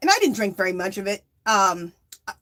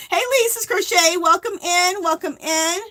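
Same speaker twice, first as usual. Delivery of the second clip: livestream greeting; 9 semitones higher, 4 dB louder, slower than usual. Real, not acted.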